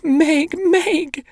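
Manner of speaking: very high sobbing shriek; little brother shrieking for his sister; shrieking with sobs. A narrator's reading. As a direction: scream her name through sobs. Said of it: "He was shrieking with sobs".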